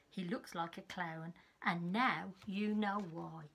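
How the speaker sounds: put-on lively read-aloud for small children; story narration. Teacher reading a picture book to her class. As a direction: tell it like a bedtime storybook.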